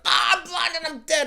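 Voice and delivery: high, hammed-up shout of a dying man; mock dying cry. Poisoned villain's brother, hammed-up dying shout. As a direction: ham up a blinded man dying. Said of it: "And the guy's like".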